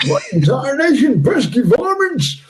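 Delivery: high, loud cartoon American drawl; stretched, hammed-up Southern cartoon voice; Deputy Dog. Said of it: "something off Deputy Dog".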